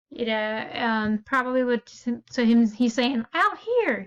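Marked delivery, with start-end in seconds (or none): 3.3-4.0 high, urgent beckoning; eager call. Shown as a demonstration. Teacher showing how Zane eagerly calls his mom outside. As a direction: pull her outside with excitement. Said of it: "trying to get her to still come".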